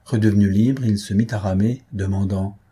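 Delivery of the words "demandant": ordinary delivery, nothing marked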